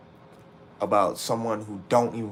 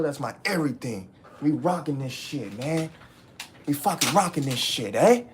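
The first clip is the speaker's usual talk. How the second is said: higher, cocky, carefree boast; mock past self; 4 semitones higher than usual. Mimicking his old cocky self to chat, carefree and proud. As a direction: play the fool who thought he had her.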